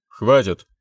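loud angry bark. A narrator's reading. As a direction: bark it out in anger. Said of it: "Зло рявкнул я".

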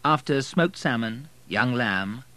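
usual speaking voice

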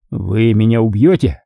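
ordinary delivery

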